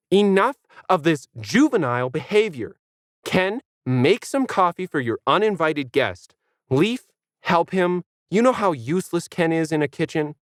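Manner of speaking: lightly voiced stern dog; bossy dog. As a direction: order everyone about. Planet takes charge.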